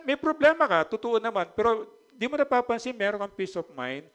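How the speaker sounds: high, loud exhortation; fervent preaching. Acted. Pastor telling congregation about peace of mind, fervent. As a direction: preach it out with conviction.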